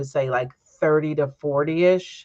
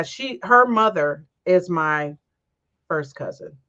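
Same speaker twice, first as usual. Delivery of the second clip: usual speaking voice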